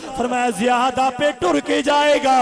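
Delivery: loud, ringing sermon chant; full-force preaching. A narrator's reading. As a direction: preach the battle story at full volume. Preaches the wounded man's return.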